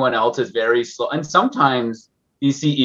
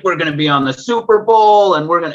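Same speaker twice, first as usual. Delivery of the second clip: higher and louder, a retold burst of hype; hyped CEO; 7 semitones higher, 4 dB louder than usual. CEO bragging to investors at the table, hyped and intense. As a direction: pump it up like an overexcited CEO. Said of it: "was so intense".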